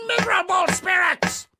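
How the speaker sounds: fast, high war cry; battle shout. Acted. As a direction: charge in yelling at the demons. Knight charging at imagined demons.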